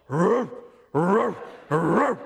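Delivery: loud, gruff imitated woofs; big dog barking. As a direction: bark like a big dog, spaced out. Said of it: "that was a big dog".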